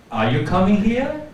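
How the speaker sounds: loud question climbing steadily to HERE; rising intonation. Shown as a demonstration. Teacher standing up as she asks, rising intonation. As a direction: let "here" swoop upward at the end. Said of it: "a rising".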